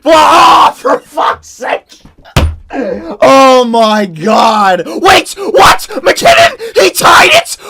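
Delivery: high, loud screams of disbelief; real overexcited screaming. Real, not acted. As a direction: scream your head off at a shock goal. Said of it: "my voice is gonzo alonzo".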